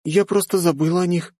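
put-on very high girl's excuse; flustered daughter. Daughter excuses herself to father, high sheepish voice.

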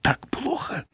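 high trembling whisper, near tears. A narrator's reading. Scared girl asking about her uncle, whispering. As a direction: breathe it out, lips shaking. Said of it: "шёпотом спросило оно".

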